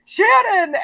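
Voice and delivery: high, loud call of her name. Retold or imitated. Racer hailing her from the bonfire, loud high call.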